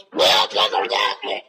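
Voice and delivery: whispered goblin babble; goblin gibberish. Retold or imitated. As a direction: mutter gibberish like a leprechaun. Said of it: "Angus goes".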